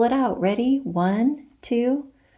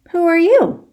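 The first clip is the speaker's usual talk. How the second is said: much higher, louder surprised "Who are you?"; startled question; 11 semitones higher, 6 dB louder than usual. Acted. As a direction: squint up at a stranger, startled. Startled by stranger in tree.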